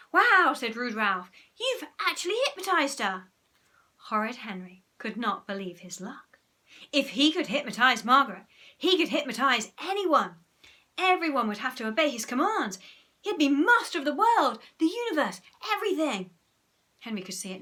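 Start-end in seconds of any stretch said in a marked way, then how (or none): 0.1-0.5 loud, high "wow"; astonished outburst; amazed. A narrator's reading. Ralph amazed by hypnosis. 1.5-3.3 high, loud disbelief; awed exclamation; amazed. A narrator's reading. Ralph amazed by hypnosis. 6.9-16.3 high, loud building narration; gleeful, mounting triumph; grandiose excitement. A narrator's reading. Henry's swelling dream of power.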